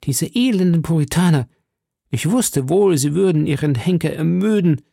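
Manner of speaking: sneering cry of contempt; calling out. A narrator's reading. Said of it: "hörte ich ihn rufen".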